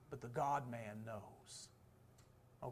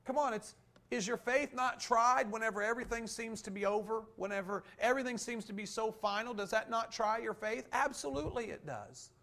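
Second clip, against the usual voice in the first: full-force preaching; 8 semitones higher, 6 dB louder than usual. Acted. Preacher pressing the congregation about tested faith, fervent. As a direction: preach it out, higher and louder.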